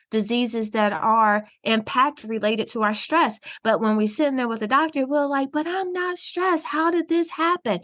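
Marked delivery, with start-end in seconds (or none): 5.5-7.8 high-pitched imitation of a puzzled patient; baffled protest. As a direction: act out bewildered denial, voice pitched up. Acting baffled patients at the doctor.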